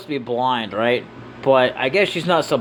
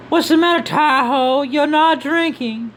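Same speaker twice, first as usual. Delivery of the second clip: high-pitched coaxing character voice; coaxing companion; 13 semitones higher, 4 dB louder, slower than usual. Acted. Companion asking Tahoe why he isn't drinking, high coaxing voice.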